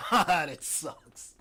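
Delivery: loud, drawn-out mock lament; exclaimed disgust. Gazing at the stars and groaning about them, loud mock dismay. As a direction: burst out loud and slow on a laugh. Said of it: "and say".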